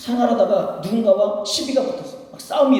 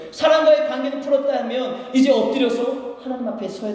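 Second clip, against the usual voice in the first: high, insistent pulpit voice; forceful preaching; 6 semitones higher than usual. Real, not acted. Pastor urging more than human reconciliation, forceful preaching. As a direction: press the congregation onward, voice lifted.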